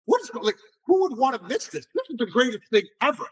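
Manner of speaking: high-pitched wonder, imitating his own awe; retold amazement. Retelling awe at his baby boy.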